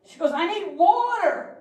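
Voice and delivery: put-on high, slow whiny child; little girl voice. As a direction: whine like a small hungry daughter. Voicing little daughter.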